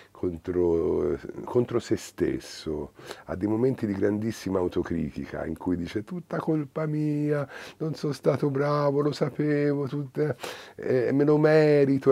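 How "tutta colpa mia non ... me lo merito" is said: quoting the puppet boy blaming himself, lightly voiced and higher; lightly voiced self-blaming Pinocchio.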